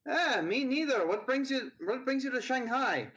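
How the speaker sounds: put-on high-pitched young woman's voice; Trinidad's voice. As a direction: speak up high as the student.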